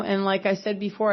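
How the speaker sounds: ordinary talk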